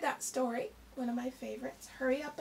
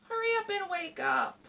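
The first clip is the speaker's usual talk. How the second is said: higher, louder, urgent nagging call; impatient hurry-up call; 8 semitones higher, 5 dB louder than usual. Acted. Hare urging Tortoise awake, loud and impatient. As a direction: Hare nags Tortoise to get going.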